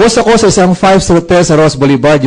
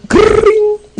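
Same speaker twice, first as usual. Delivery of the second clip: phone ring imitation.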